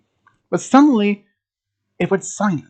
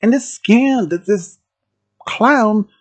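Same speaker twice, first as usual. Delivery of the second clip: usual speaking voice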